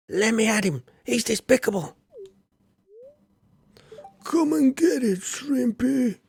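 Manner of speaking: squeaky battle cry of a tiny fighter; feisty fighter. Acted. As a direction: taunt him like a scrappy brawler. Voicing fighting game character.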